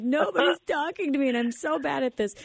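laughing mock whine. Hiding in the stall, laughing self-pity. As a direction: whine at yourself while laughing.

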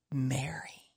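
hushed, breathy whisper of her name; Jesus whispering Mary. Acted.

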